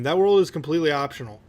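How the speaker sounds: ordinary talk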